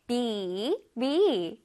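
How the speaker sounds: "bee" said with a stretched ee; long vowel in bee. Shown as a demonstration. Teacher repeating bee for pupils to copy, long vowel. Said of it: "길게 발음해줘야 돼".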